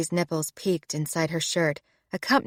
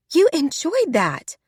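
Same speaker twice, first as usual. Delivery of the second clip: higher, slow, playful mock outrage; mock accusation with mirth; 9 semitones higher, 4 dB louder than usual. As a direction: accuse him while half laughing. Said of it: "her body shaking with mirth".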